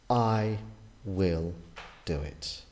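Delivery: slow "I" then "will", falling away to a hush on "do it"; slow, emphatic "I... will... do it"; stress on I will. Shown as a demonstration. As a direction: lean hard on "I" and "will", pause between each. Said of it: "The emphasis is always on him".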